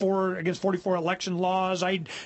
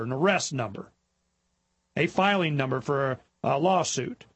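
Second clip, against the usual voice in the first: ordinary talk, nothing marked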